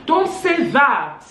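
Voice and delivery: high, loud indignant protest; heated quarrel. Retold or imitated. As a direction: re-enact an outraged shouting match. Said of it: "they just heard noise".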